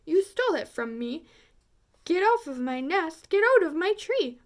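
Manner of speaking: fast, rising to a loud high shriek; angry tirade building. A narrator's reading. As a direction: drive them off in a rage. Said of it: "she spouted".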